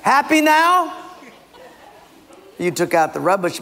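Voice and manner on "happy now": high, loud sarcastic jab; hammed-up huffy demand; acted resentment. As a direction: throw it at the wife, pitched way up. Husband after angrily doing the chore.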